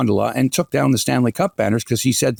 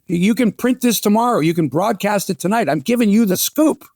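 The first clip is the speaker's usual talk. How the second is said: quoting the owner; 8 semitones higher than usual. Retold or imitated. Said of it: "he's telling you".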